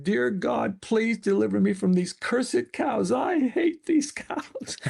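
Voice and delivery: retold desperate prayer, pitch climbing, last words drawn out; imitating yelled prayer. Father as a boy praying alone among hated cows, imitated high-pitched yell. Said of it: "I yelled my prayer as loud as I could".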